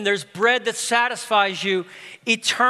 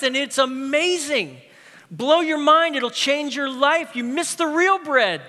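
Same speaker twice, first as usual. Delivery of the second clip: high, urgent and pleading; almost exasperated, urging them back; John telling the crowd what they missed; exasperated plea; 7 semitones higher than usual. Retold or imitated. John scolding the departing crowd.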